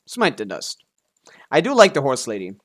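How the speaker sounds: ordinary talk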